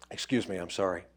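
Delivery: usual speaking voice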